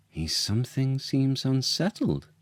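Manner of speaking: a hint of the queen, wondering aloud; queen's voice. A narrator's reading. Queen musing on her husband's mood, lightly voiced. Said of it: "said Hermione".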